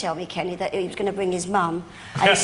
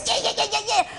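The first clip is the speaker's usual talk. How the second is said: high mock dismissal; 12 semitones higher than usual. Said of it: "I went".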